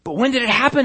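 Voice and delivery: high, loud eager asking; eager question. Acted. Curious father pressing servants for when it happened. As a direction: press them for the time.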